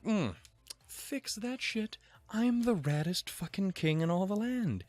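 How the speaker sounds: hammed-up arrogant king; smug mock king. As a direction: play a bratty, lofty king. King voiced in retelling.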